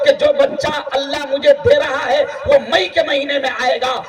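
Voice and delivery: impassioned sermon shout; fiery preaching. Acted. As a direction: rouse the congregation. Preaching at full force.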